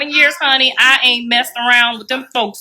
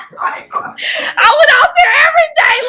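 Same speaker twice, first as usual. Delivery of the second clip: half-laugh, half-shout it; 13 semitones higher than usual. Real, not acted. Laughing loud retelling.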